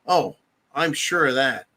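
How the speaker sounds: usual speaking voice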